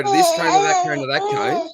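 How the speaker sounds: put-on high-pitched teacher calling to little kids; mock teacher call. Teacher retelling herding preps at cross country, high mock call. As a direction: sing it out like herding preps between cones.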